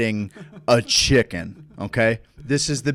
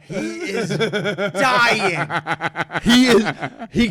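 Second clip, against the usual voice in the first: high, drawn-out words through laughter; laughing; 6 semitones higher, 3 dB louder, much slower than usual. Real, not acted. Telling how the buddy was dying, laughing. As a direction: barely get it out for laughing.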